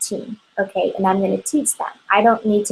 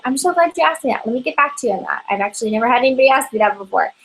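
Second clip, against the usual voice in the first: eager answer demo; 3 semitones higher, much faster than usual. Shown as a demonstration. Consultant demonstrates answering without panic, quick and bright. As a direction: rush out a bright, pleased reply. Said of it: "always ways to answer".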